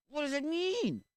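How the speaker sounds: high, loud mock wail; exasperated outburst. Exasperated at neutral people.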